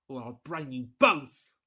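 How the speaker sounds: slow, loud, menacing warning; menacing threat. A narrator's reading. Invisible man threatening the two men, slow and loud. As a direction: lean on every word as a threat. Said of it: "whispered a voice".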